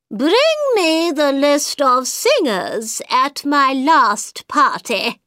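slow, high royal command; Queen voice. A narrator's reading.

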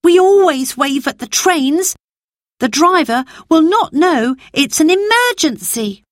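put-on a boy warning of disaster; worried boy Peter. Narrator voices Peter explaining.

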